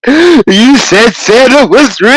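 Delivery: a hint of a wailing child, laughing through it; imitating a sobbing kid, lightly; mock crying child. Dad mimics his son caught finding out about Santa, mock crying. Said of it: "the way he cried".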